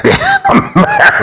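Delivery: laughing. Real, not acted. Laughing at the contrast.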